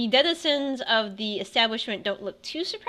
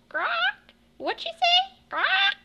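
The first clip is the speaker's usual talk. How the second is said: much higher, squawky parrot voice; parrot squawk. Acted. Parrot butting into the tavern talk, squawking high. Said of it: "and the mar-, parrot goes".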